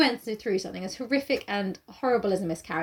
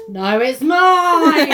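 slow, loud dramatic claim; milk it like a soap cliffhanger; soap opera drama; 4 semitones higher, 11 dB louder, much slower than usual. Retold or imitated.

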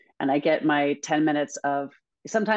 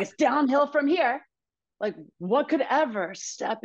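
dramatic despair; 7 semitones higher than usual. Retold or imitated. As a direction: ham up the hopeless thought. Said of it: "I was like".

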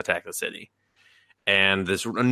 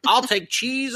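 squeaky dragon guy; slower than usual. Retold or imitated. Dragon guy ordering cheese.

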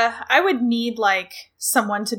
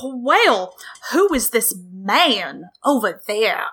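put-on drawn-out Southern belle accent; slow, swooping Southern drawl, hammed up; Blanche Devereaux; slower than usual. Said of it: "you are Blanche Devereaux".

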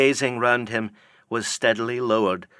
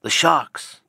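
louder cry of The sharks!; alarmed cry; 5 semitones higher, 4 dB louder than usual. Acted. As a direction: warn of The sharks! with a raised voice. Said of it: "cried a voice from the low cabin window".

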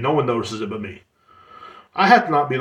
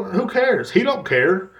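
normal talking voice

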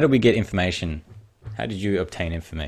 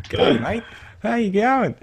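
much higher mock Aussie-style greeting; mock Aussie-style accent. Teasing friend about his librarian voice, mock Aussie-style accent. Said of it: "It wasn't Australian".

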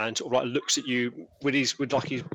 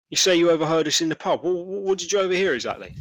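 lightly voiced trader, louder and quicker at first; probing stallholder; 5 semitones higher, 6 dB louder, faster than usual. Stallholder quizzing the reporter who overheard him, probing. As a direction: press the eavesdropper for an answer.

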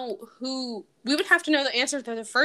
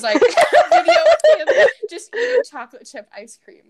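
laughing; 13 semitones higher, 12 dB louder than usual. Real, not acted. Laughing while telling story.

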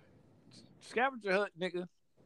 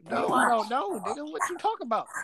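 baffled; 8 semitones higher than usual. Retold or imitated. Retelling his baffled reply.

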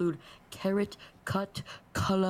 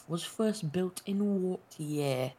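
normal talking voice

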